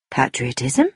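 low, mildly puzzled echo, rising at the end; surprised. A narrator's reading. Tommy echoing the girl's word, mildly surprised. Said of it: "Tommy was surprised".

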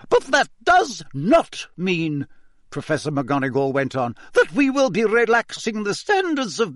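a strict teacher laying down the law; stern warning teacher. Acted. Teacher warning Gryffindors about behaviour, severe and high.

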